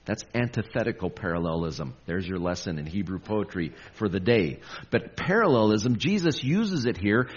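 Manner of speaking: ordinary talk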